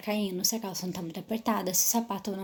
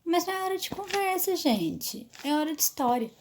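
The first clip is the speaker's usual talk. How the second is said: high scolding of the chatty group; the wrong way, shushing the class; telling the class off; 7 semitones higher than usual. Shown as a demonstration. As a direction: nag the class to stop talking. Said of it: "Não chame a atenção da criança".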